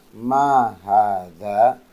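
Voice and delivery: ma hadza with ma drawn out long; long vowel on ma, slow. Shown as a demonstration. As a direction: stretch the ma slowly, then rise. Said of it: "Ma-nya dengan diucapkan di panjang".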